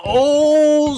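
high, loud, drawn-out oh; big excited exclamation; amazed whoop. Pretend. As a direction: whoop as it collapses. Building gives way.

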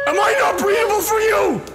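high cracking shout; laughing shout. Real, not acted. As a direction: yell in disbelief. Yelling at game monster.

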